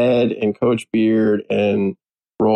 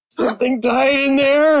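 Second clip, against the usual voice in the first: high-pitched hammed-up disgust; mock disgusted Roy; 14 semitones higher than usual. Fan retelling a gross-out scene, in a high mock-disgusted voice. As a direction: squeal it out like the smell knocked him flat. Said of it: "and he's like".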